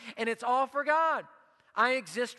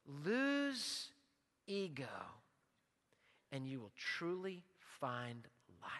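soft, slow, sinking to near a murmur; hushed altar-call voice; hushed appeal; 8 semitones lower, 11 dB quieter, slower than usual. Real, not acted. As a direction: draw them in quietly, hand raised. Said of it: "and I raise my hand".

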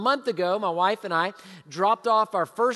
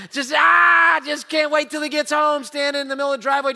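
high, loud burst of eagerness; can't contain the excitement; a father bursting to see his son; excited; 6 semitones higher, 6 dB louder than usual. Real, not acted.

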